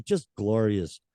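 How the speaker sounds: normal talking voice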